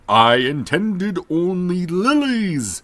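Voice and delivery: high, slow, loud shout of the magician; desperate shout. A narrator's reading. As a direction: cry it out to the lantern light, slow and loud. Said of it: "shouted the magician".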